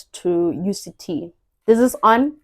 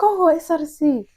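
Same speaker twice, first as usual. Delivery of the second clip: realizing; 8 semitones higher than usual. Retold or imitated. Sudden realization.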